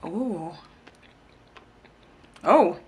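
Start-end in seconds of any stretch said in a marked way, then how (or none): none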